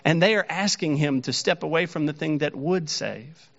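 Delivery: normal talking voice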